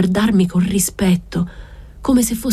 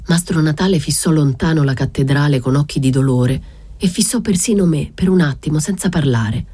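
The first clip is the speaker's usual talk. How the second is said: plain reading voice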